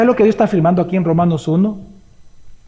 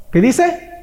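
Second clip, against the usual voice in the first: normal talking voice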